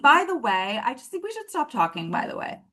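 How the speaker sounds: high, airy, falsely casual brush-off; mock breezy kindness. Retelling her breakup voice note to him, mock breezy kindness. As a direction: drop it sweetly, as if it were nothing. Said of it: "I oh so kindly sent him".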